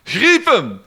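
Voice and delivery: loud, high shout of "seize him"; shouted command. A narrator's reading. Furious father orders soldiers to seize the knight, shouting. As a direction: bark the order at the guards. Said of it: "schreeuwde de vaaier".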